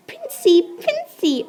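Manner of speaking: high squealing witch voices; witches crying out. Acted. Witches gushing over the prince, high squeal. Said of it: "all the witches cried".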